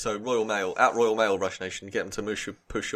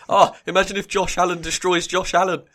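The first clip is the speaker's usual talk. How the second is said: much higher and louder, thrilled; excited; 9 semitones higher, 10 dB louder than usual. Real, not acted. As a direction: burst out with delight at the thought. Excited about a funny idea.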